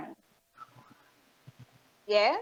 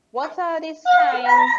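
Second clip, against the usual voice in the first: louder, slow and drawn out question; emphatic question; 6 semitones higher, 9 dB louder, slower than usual. Real, not acted. Host pressing the guest on stress signs, loud and slow. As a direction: press the question home slowly. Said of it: "Very good question".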